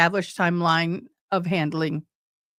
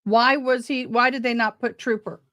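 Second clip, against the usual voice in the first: normal talking voice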